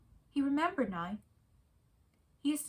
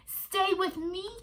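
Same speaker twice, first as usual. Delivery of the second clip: wife's voice; 9 semitones higher, slower than usual. A narrator's reading. Wife pleading softly.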